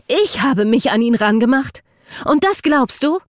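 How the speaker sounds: high, loud incredulous questions; bitter disbelief. A narrator's reading. Eden repeats Jackson's accusation, bitterly incredulous. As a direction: fling the accusation back at him in outrage. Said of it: "Eden lachte bitter".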